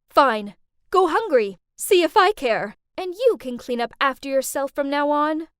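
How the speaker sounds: loud, fed-up scolding, easing toward the end; angry retort. Acted. Mom after daughter trashes her dinner, angry retort. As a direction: snap back, washing your hands of it.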